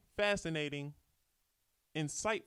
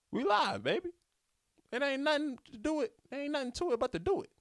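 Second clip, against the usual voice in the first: excited; 12 semitones higher than usual. Real, not acted. Hyped about starting recording.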